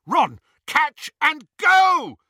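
high, loud, slow urgent yell. Acted. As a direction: yell the order. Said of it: "he yelled".

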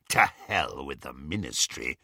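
low werewolf growl. A narrator's reading. Greyback to the snatchers, low growl. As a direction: growl it with contempt. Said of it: "growled Greyback".

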